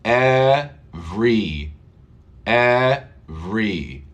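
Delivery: "Every" spelled out slowly, syllable by syllable; slow syllable drill. Shown as a demonstration. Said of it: "Look at this".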